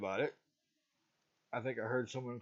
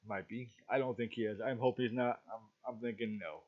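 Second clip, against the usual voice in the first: ordinary delivery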